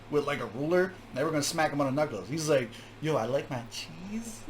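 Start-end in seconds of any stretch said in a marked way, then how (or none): 3.0-4.4 softer and slow, as if sneaking the word; sneaky quiet delivery. Retold or imitated. Mocking the second rapper's sneaky line to the viewers, soft and slow. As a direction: slip it out like a forbidden word. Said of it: "Like, you were sneaking it".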